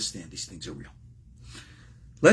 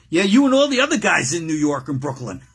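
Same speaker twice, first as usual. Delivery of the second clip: louder, higher comic comeback; Costello; 4 semitones higher, 7 dB louder than usual. Acted. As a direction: toss back a loud wisecrack. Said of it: "Costello looks at him and says".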